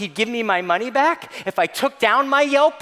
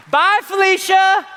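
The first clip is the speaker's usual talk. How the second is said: sassy bye Felicia; 8 semitones higher, 4 dB louder than usual. Pretend. Sassy Real Housewives send-off.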